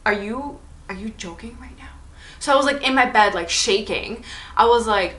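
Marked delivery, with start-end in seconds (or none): 0.0-1.9 whisper. Retold or imitated. Hiding from a knocker at night.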